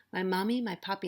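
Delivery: ordinary delivery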